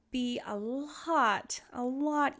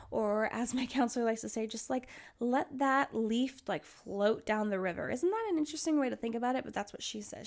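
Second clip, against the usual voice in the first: usual speaking voice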